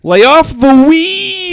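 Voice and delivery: slow high shout imitating a sports pundit; Stephen A impression. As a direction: bellow it like a TV pundit. Quoting Stephen A.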